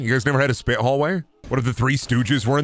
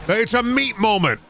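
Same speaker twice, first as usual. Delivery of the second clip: sudden high announce; 12 semitones higher than usual. Acted. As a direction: jump way higher for a big hyped reveal. Hyping up the meat moment.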